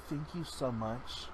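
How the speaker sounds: soft, slow, choked-up thanks; tearful lady. Retold or imitated. The crying lady at Walmart, soft teary thanks. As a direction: lightly voice a teary thank-you. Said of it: "she's like".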